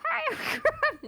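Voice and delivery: high, slow, exaggerated cheery greeting; hammed-up perky voice; cheerful Scrub impression. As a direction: pitch it way up and stretch it out. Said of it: "That's not even his accent".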